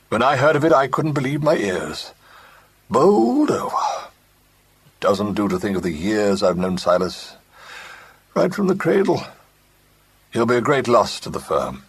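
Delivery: a hint of a hearty old partner, condoling; pompous sympathy, lightly done; lightly voiced older businessman. A narrator's reading. Joe Mansell condoles with Clement at the office, lightly voiced.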